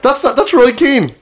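high, drawn-out mock Cork voice; Roy Keane. Comic defending his impression to doubtful co-hosts, high mock Cork voice. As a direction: stretch it out in a squeaky comic impression. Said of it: "So that's me doing Roy Keane".

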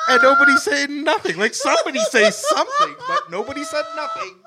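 can barely get it out for laughing. Real, not acted. Friend laughing while retelling the silent crowd.

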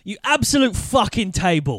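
playing furious in an English accent; mock angry insult. Shown as a demonstration. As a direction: hurl the noun like an insult. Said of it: "sounds like an insult if they're angry enough".

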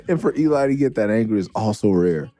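ordinary delivery

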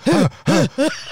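high, loud gasp; loud mock gasp. Telling how the friend sprang up, mock gasp. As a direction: gasp like a witch waking.

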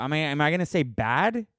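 normal talking voice

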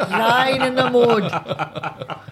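drawn out mocking wail; mock mother's lament. Recalling the cheesy show, mocking lament, laughing. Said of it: "one mother voice saying".

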